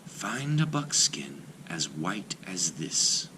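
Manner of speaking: lightly voiced maiden, slow and hushed; a hint of a gentle dream maiden, slow; young maiden. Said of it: "she told him".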